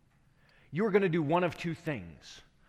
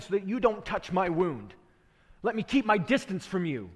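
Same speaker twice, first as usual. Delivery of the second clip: defensive deflection; 4 semitones higher, 7 dB louder than usual. Acted. Woman deflecting Jesus with debate.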